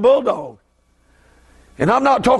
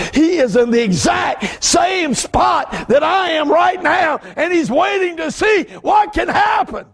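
high, slow shouted preaching; preaching at full force; 8 semitones higher, slower than usual. Real, not acted. Preacher shouting about his friend to the church. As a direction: call it out to the church.

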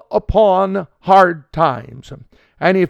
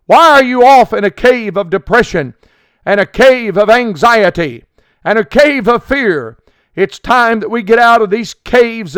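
louder, pressing preacher's appeal; fiery preaching; 3 semitones higher, 4 dB louder than usual. Acted. Preacher urging radio listeners out of the cave, fiery. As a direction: drive the call home to the flock.

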